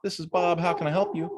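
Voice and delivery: high, bright phone greeting; imitating the friendly store greeting he never gave; cheery receptionist greeting. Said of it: "he doesn't say".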